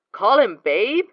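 can't believe what he heard; incredulous. Acted. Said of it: "He sounds incredulous".

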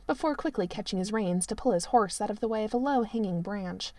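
usual speaking voice

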